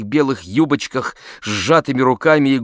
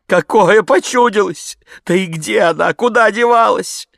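tearful voice; 10 semitones higher, 5 dB louder than usual. A narrator's reading. Maid answers the gentleman, tearfully wailing. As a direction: wail like a distraught peasant maid. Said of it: "заговорила слезливым голосом".

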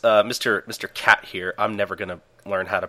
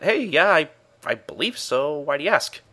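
high-pitched acted secretary; lightly put-on high voice for a woman; secretary's voice; 7 semitones higher, 3 dB louder than usual. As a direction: pitch it up as a chirpy assistant.

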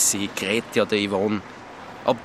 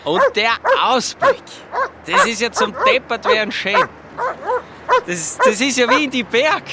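much higher and louder, overjoyed; thrilled; 5 dB louder than usual. Acted. Man gushing to Lisi about the view, high and loud. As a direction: gush over the view like a mountain peak.

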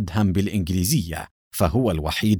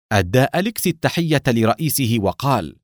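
usual speaking voice

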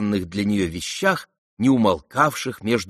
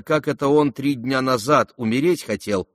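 plain reading voice

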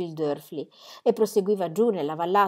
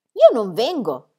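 put-on slow, louder childish protest; stubborn refusal; 3 semitones higher, 7 dB louder, slower than usual. A narrator's reading. Little girl refusing to go with her aunt, stubborn. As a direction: dig your heels in, slowly.

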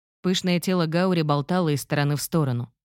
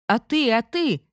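crying out; 7 semitones higher than usual. Acted. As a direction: call out to him in alarm. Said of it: "закричала Гаури".

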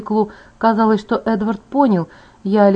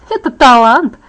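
loud, high, amused boast; gleeful; 4 semitones higher, 7 dB louder than usual. A narrator's reading. Alice answers being called irritating, gleefully boasting. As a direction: show off with glee. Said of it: "Элис рассмеялась".

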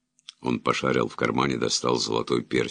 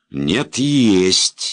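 offended; 7 semitones higher, 6 dB louder than usual. Acted. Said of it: "неожиданно обиделся".

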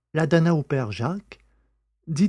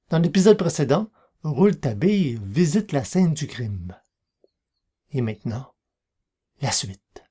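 radio serial announcer voice; announcer intro; 3 semitones higher than usual. Acted. Host introduces the next chapter of the serial. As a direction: announce the episode.

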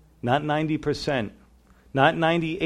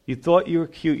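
usual speaking voice

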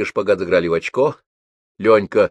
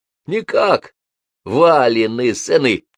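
put-on much higher, slow joyful old man; delighted surprise; 4 semitones higher, 4 dB louder, slower than usual. A narrator's reading. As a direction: gasp in joy at the grandsons. Old man delighted, recognizes grandsons. (радостное удивление старика)

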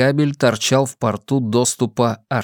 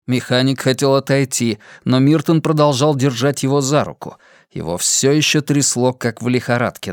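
ordinary delivery, nothing marked

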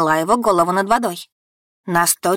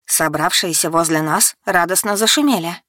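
ordinary delivery

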